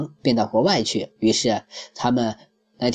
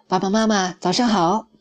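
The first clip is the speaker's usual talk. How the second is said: bright cheerful greeting, clearly higher; happy; 7 semitones higher than usual. A narrator's reading. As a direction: greet mom and dad gladly, pitched up. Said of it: "高兴地说".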